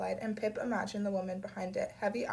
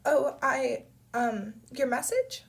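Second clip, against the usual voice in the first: higher, louder halting question; stuttering confusion; 5 semitones higher, 6 dB louder, slower than usual. A narrator's reading. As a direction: stumble over it, caught off guard. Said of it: "Pip stuttered".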